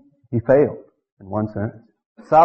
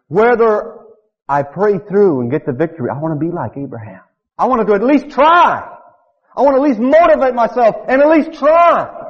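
high, louder, building intensity; preacher stirring the congregation; rising exhortation; 7 semitones higher, 3 dB louder than usual. Real, not acted. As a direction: push it up to a climax.

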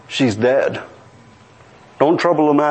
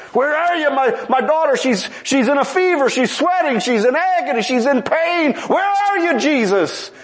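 high, pleading cry of a desperate father; anxious father; 11 semitones higher than usual. Acted. Father begging Jesus to come to his dying daughter, high anxious plea. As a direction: beg for him to come, voice pitched up high. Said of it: "that father's a little bit anxious".

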